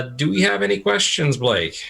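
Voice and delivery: usual speaking voice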